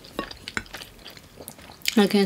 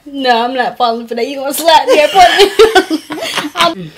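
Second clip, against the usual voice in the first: loud and high, laughing through; 7 semitones higher, 10 dB louder than usual. Real, not acted. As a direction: protest while laughing. Laughing, refusing trick.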